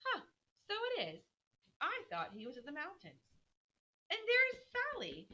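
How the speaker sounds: high pitched delighted exclaiming; excited spotting. Acted. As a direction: point out a friend, delighted. Beth spotting people.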